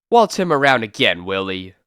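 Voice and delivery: ordinary delivery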